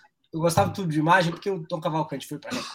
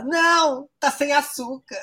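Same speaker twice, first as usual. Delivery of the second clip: high-pitched, hammed-up comeback; drag escaldada respondendo; 9 semitones higher, slower than usual. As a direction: play the scalded drag, voice way up, stretched ending. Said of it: "Aí ela fala". (resposta aguda e teatral de drag)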